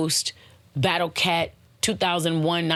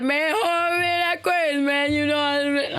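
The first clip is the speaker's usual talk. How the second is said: hammed-up Southern accent, pitched way up; thick Southern accent; 9 semitones higher than usual. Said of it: "Oh, he's so South".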